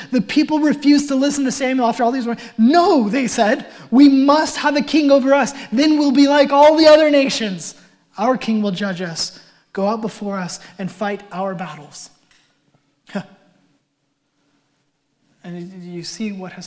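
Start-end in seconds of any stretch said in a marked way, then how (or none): 0.1-12.1 louder, higher fervent reading; loud fervent sermon reading; preaching at full force. Acted. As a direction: proclaim the verse to the hall.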